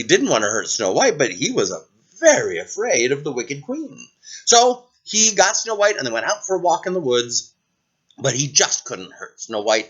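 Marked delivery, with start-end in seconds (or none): none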